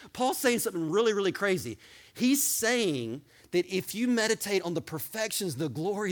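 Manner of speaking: usual speaking voice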